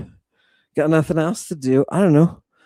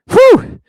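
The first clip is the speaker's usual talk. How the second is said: sharp, loud, high-pitched whew; 7 dB louder than usual. Real, not acted. Host recalls a brutal rugby match, high whew. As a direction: let out a high "whew" of amazement.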